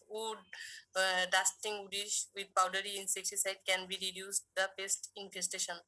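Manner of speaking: very slow, word by word; slow dictation. Shown as a demonstration. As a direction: dictate it slowly for notes. Teacher dictates answer to students.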